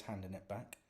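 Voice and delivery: plain reading voice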